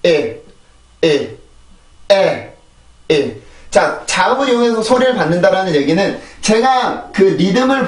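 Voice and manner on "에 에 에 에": 에 alone, a short falling vowel sound; isolated vowel. Shown as a demonstration. Teacher drills only the vowel part, isolated vowel. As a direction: sound out just the vowel 에, separated by pauses. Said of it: "여기만 해볼게요".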